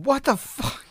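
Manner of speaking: lightly voiced stunned dad; shocked dad. Dad walks in on scene.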